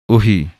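teacher models ਉਹੀ as a quick light ohi; short light ohi. Shown as a demonstration. Teacher to students, showing ਉਹੀ said quick and light. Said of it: "ਇਹ ਪਾਠ ਬੋਲਣਾ ਹੈ".

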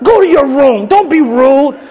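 high-pitched parental telling-off; mock scolding. Parent scolding a small son before a guest, mock stern.

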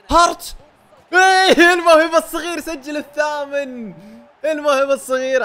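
high, loud triumphant yelling; excited goal shout. Real, not acted. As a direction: scream the goal out in pure joy. Young talent scores in game.

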